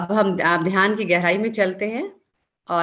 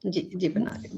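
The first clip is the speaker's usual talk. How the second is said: usual speaking voice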